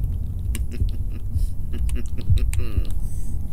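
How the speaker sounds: long laugh in short bursts. Real, not acted. Laughs after being caught by game.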